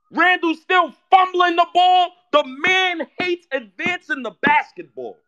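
loud, high shouting in disbelief; furious yelling. Real, not acted. Fan yelling at RJ and Randle, loud and high. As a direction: yell it at the players through the screen.

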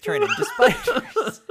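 high squealing laugh through words. Real, not acted. Joking sexy dream twist.

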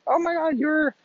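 high, mock-excited neighbor voice; gushing neighbors. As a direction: squeal it like a gossiping neighbor. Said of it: "all the neighbors are like".

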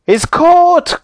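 very high, loud triumphant cry; joyful cry. A narrator's reading. Moonface has tied up the sleeper, joyfully crying out. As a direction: call it out in triumph. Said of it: "cried Moonface joyfully".

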